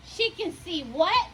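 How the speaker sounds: ordinary delivery, nothing marked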